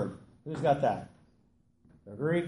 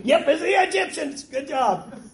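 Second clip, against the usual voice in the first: laughing it out, higher and louder; 12 semitones higher, 8 dB louder than usual. Real, not acted. Teacher laughing at the class's mix-up, laughing. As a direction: blurt it through a real laugh.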